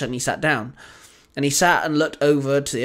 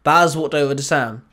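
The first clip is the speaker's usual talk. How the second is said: ordinary delivery, nothing marked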